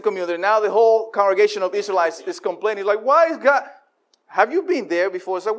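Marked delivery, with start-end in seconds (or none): 3.0-3.6 a complainer's high-pitched protest; whiny complaint. Acted. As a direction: whine it at heaven. Congregation's complaint voiced in prayer.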